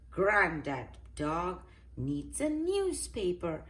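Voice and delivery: usual speaking voice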